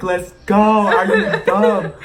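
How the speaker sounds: high, loud, laughing "let's go, are you dumb"; exasperated shout. Retold or imitated. Yelling at Natalie to run, laughing in disbelief. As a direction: yell at her to move, half laughing.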